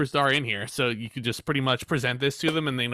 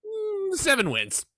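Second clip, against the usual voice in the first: high, drawn-out hum of consideration; pondering retold answer; much slower than usual. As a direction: mull it over slowly, pitched up high. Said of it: "he stops, he thinks for a minute".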